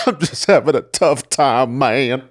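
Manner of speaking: lightly camp voice, drawn out. Retold or imitated. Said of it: "that was the gayest voice I've ever heard".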